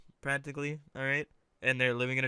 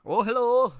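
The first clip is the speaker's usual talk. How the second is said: higher-pitched go at Welsh; mock-Welsh greeting, pitched up; attempted Welsh accent; 8 semitones higher, 4 dB louder than usual. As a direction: try out a bad Welsh accent. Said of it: "amazing Welsh accent".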